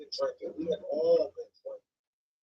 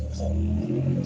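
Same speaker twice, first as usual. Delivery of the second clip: much lower, slow menacing voice; deep tough guy; 5 dB louder than usual. Retold or imitated. Storyteller replaying his threat in a deep slow voice. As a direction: drop low and slow, daring him.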